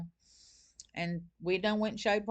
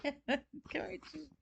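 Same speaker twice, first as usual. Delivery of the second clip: much higher, laughing coax to a pet; coaxing the dog; 7 semitones higher, 7 dB quieter, slower than usual. Real, not acted. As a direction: sweet-talk the dog into coming. Calling her shy dog over.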